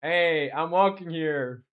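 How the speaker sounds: slow, drawn-out New Yorker "Hey, I'm walking here"; lightly done New Yorker accent, slow and deliberate. Shown as a demonstration. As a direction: play an offended New York pedestrian in a New Yorker accent. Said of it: "like a New Yorker accent".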